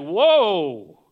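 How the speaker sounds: long, loud amazed whoa; awed whoa. Acted. Sailors seeing the sea calm, loudly amazed.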